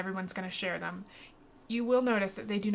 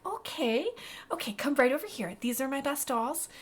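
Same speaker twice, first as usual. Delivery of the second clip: higher-pitched shopkeeper smoothing over awkwardness; Honeywell; 5 semitones higher than usual. Acted. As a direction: usher them along, pitched up. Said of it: "she catches the really awkward".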